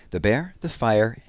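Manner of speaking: ordinary delivery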